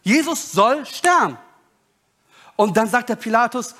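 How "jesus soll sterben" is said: high, emphatic group demand; insistent crowd demand. Acted. Crowd answering Pilate about Jesus, insistent and high-pitched. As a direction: call for the death in high, weighted words.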